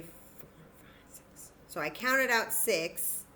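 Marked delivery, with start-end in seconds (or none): 0.0-1.5 whispered counting. Real, not acted. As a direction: count under your breath. Counting cubes softly.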